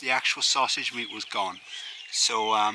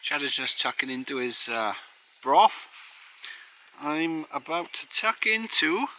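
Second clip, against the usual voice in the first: ordinary talk, nothing marked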